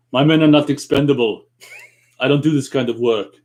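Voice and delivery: firm Austrian commando refusal; emphatic Austrian-accented movie impersonation; Arnold Schwarzenegger. Acted. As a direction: stand your ground as an action hero. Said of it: "this line from Predator I'm really good at".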